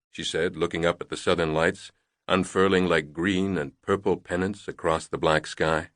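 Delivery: plain reading voice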